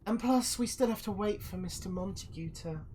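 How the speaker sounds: high, silky put-on voice; villainous envoy. Envoy promises the guest safe passage, high and formal. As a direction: deliver the assurance in high, measured tones. Villain delivers lord's invitation.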